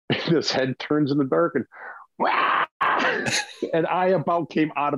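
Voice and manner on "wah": short mock yelp of fright; startled yelp. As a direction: reenact the jump-scare cry at the owl. Owl turns its head in dark.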